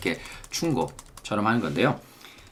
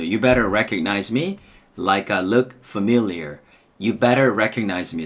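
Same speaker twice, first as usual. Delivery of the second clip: slow drill read; much slower than usual. Shown as a demonstration. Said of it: "사운드체크부터 가겠습니다".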